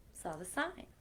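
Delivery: usual speaking voice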